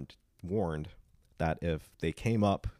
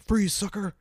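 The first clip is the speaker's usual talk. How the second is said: high, loud, hammed-up tough-guy shout; mock tough guy; 6 dB louder than usual. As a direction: play at being tough and shout it. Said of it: "trying to act all tough".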